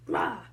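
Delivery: short, loud "rah"; big roar. Shown as a demonstration. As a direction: burst out a pouncing roar. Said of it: "make, like, a big sound".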